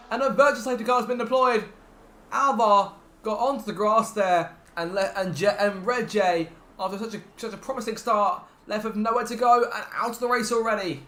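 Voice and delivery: loud, urgent race commentary; motorsport commentator. Acted. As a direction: keep the energy up after the crash. Safety car after crash.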